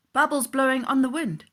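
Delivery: ordinary delivery, nothing marked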